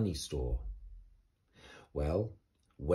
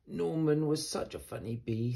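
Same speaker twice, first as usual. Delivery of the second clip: sighing bees; 6 semitones higher than usual. Acted. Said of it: "sighed the bees".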